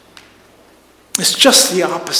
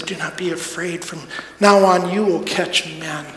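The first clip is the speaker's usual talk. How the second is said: gentle reassurance; 3 semitones lower, 7 dB quieter than usual. Retold or imitated. Jesus comforts kneeling Peter.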